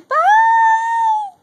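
very high, loud, drawn-out farewell; squealed goodbye. Real, not acted. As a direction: shout an excited sign-off to viewers. Squealed sign-off to viewers.

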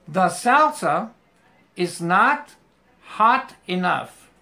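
The salsa is not hot enough in slow separate chunks; slow, word by word. Shown as a demonstration. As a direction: break it into pieces for the class. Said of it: "vas a poner hot primero y después enough". (despacio, palabra por palabra)

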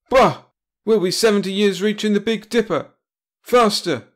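loud, high scoffing order; impatient outburst. A narrator's reading. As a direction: blast him for the slowness. Captain furious at slow ship.

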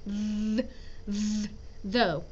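"th, th, though" with the tongue between the teeth, a soft voiced th; th sound drilled. Shown as a demonstration. Teacher showing students the TH sound. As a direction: slowly isolate the "th" then say "though". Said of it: "It's a T H sound in the middle".

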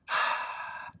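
long unvoiced exhale; heavy sigh. Real, not acted. Fan worn out recapping the fight, audible sigh. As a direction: let out a long, soft breath.

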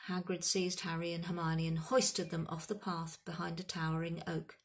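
usual speaking voice

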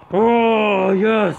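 loud, high cheer; excited shout. Real, not acted. Gamer cheering his dunk, loud and high. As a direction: yell it out in triumph. Celebrates a big play.

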